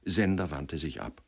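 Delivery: usual speaking voice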